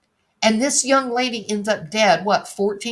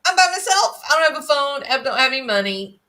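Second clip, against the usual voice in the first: higher whiny mock plea; quoting Brian's plea; 5 semitones higher than usual. Reading out Brian's stranded complaint, mock whiny. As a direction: whine it like a stranded helpless kid. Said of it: "Charmaine Lilly says".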